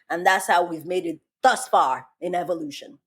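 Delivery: normal talking voice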